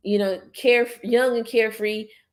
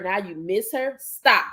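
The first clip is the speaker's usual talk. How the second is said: normal talking voice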